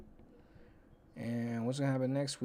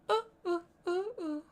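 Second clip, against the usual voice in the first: high excited oohs; 4 dB louder than usual. Real, not acted. Sudden high oohs at the game.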